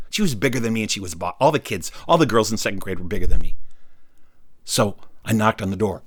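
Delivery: ordinary delivery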